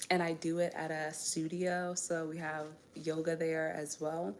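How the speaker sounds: usual speaking voice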